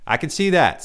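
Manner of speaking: high reedy dry reply; a lightly voiced strange man; light reedy stranger. A narrator's reading. Stranger answering Ryan, high reedy voice. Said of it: "light, reedy voice".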